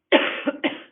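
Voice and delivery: rough repeated cough. Real, not acted. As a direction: cough it out hard.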